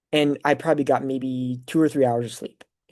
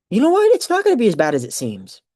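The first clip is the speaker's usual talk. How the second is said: rallying self-talk, pitched up at the start; emphatic realization; 9 semitones higher, 5 dB louder, faster than usual. Retold or imitated. Nervous graduate taking up a classmate's advice, emphatic realization. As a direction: burst in high and loud, then settle. Echoes advice as sudden resolve.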